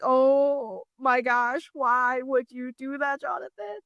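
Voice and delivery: slow, high, drawn-out disbelief; mock dismay. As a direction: stretch out the scolding surprise. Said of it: "and I'm like".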